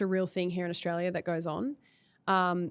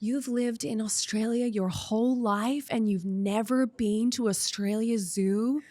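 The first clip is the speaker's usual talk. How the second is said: slow, higher-pitched American accent imitation; Terry Irwin's American accent; 3 semitones higher, 3 dB louder, slower than usual. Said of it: "yeah, I'll do it in an accent".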